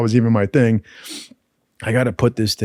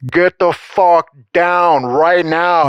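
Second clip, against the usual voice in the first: slow, louder, distant megaphone shout; megaphone order; 5 semitones higher, 4 dB louder, much slower than usual. Retold or imitated. Instructors to the man on the tower, slow megaphone shout. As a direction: bark it through a megaphone at the tower. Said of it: "they pull out a megaphone".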